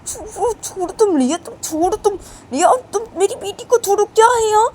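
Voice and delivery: high, loud furious shout; frantic mother yelling, near tears; angry mother. Acted. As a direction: scream at her to let go. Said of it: "पी बोलती कि".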